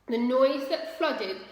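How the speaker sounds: plain reading voice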